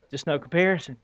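normal talking voice

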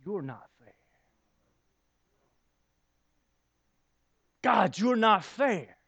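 slow, hurt "God, you're not fair"; aggrieved accusation. Retold or imitated. Believer accusing God of unfairness, slow and hurt. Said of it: "we say".

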